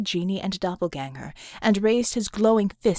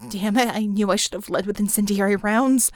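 ordinary delivery

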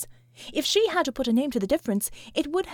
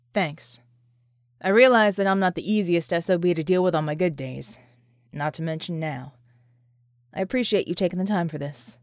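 a lower soldier's voice, gruff but thankful; a tough man admitting he's hard to deal with; John's voice, grateful; 5 semitones lower than usual. A narrator's reading. John thanks Vicky.

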